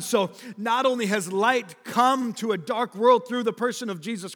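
high, loud preaching at full force; rally the congregation, voice lifted high; fervent preaching. Acted.

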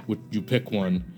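ordinary talk

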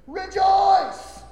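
high, loud proclaiming shout; shouted rejoice. Acted. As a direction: cry rejoice out to the church. Preacher proclaims scripture.

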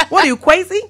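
high, loud mock French, laughing; hammed-up French caller; bad French accent. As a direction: say crazy in a badly faked French accent. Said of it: "how they say crazy".

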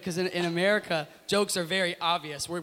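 ordinary talk

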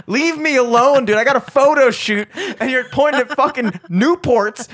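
high, loud, hammed-up indignant rant; mock outrage. Fake-innocent customer to clerk, mock outrage. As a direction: play the wrongly accused customer, overacting. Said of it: "I'm the greatest actor of all time".

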